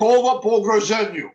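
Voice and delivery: high, loud Russian line recital; a Russian commercial line, high and loud. Shown as a demonstration. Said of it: "learn my lines in Russian".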